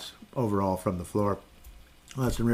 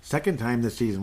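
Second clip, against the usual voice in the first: usual speaking voice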